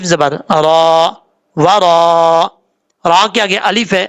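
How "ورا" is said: ورا with the alif stretched for three alifs; long madd. Shown as a demonstration. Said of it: "تین الف کے برابر ہے". (ورا کو تین الف تک لمبا)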